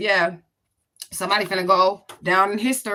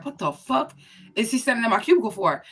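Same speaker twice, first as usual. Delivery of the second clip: higher, baffled reaction to finding him there; retold surprise; 4 semitones higher than usual. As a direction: act out the confused double take. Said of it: "And I was like".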